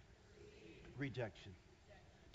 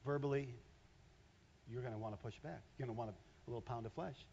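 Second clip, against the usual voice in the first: normal talking voice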